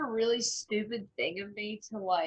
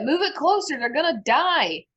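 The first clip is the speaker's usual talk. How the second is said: high, loud urgent yell; urgent shout; 8 semitones higher, 8 dB louder than usual. Acted. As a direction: yell a desperate command. Urging the Warforged to hurry.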